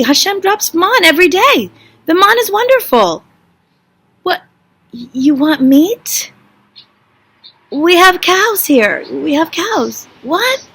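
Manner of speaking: high-pitched, hammed-up disbelief; incredulous exasperation. Leader answering people who complain about food, exasperated. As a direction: protest as if you cannot believe your ears. Acts Moshe's outraged reply to complainers.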